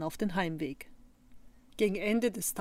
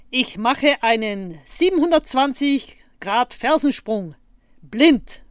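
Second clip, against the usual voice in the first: slow, loud showman's announcement; big, drawn-out proclamation; boastful announcement; 4 semitones higher, 8 dB louder, slower than usual. A narrator's reading. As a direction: announce a daring stunt, weighing each word. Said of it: "kündigte er an".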